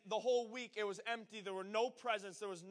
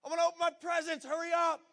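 put-on high, very loud kid yelling for parents; excited yelling; 8 semitones higher, 12 dB louder than usual. Child yelling for Mom and Dad to open presents, excited shout. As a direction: holler through the house, bursting with impatience. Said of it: "you start yelling".

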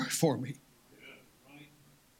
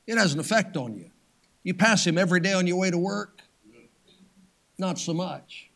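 ordinary delivery

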